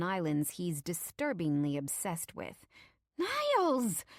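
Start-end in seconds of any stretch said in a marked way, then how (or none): 3.2-4.0 high, loud drawn-out scolding of a husband; exasperated wife reining him in; hissed rebuke. A narrator's reading. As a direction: hiss his name sharply in warning. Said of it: "the queen bitch hisses behind him".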